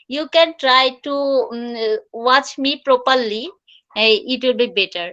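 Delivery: very slow, deliberate English; slow coaching. Shown as a demonstration. Teacher urging learners to watch her mouth, slowly. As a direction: coach them along slowly. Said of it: "coaching learners".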